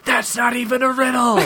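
high, put-on wail of protest; shouted last words. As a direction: cry out as a falling snake head. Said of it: "shouted their final words".

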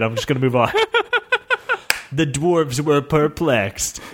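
ordinary delivery, nothing marked

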